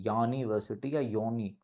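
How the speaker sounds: ordinary talk, nothing marked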